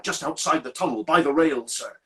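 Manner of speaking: usual speaking voice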